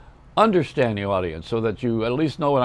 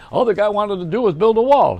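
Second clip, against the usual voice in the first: higher, punchy comic voice; higher, louder stand-up delivery; Jackie Mason; 7 semitones higher, 3 dB louder than usual. Retold or imitated. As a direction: toss off the line like a wisecracking comic. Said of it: "He says".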